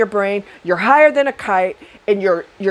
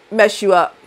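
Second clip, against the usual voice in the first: usual speaking voice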